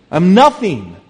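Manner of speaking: loud, emphatic admission; emphatic self-abasement. Acted. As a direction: punch it out high and loud. Undercutting the mock boast, emphatic.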